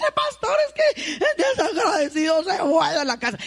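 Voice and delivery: high, loud, indignant mother's whine; mock the offended mother; complaining mother. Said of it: "la mamá dice". (madre quejosa, voz aguda)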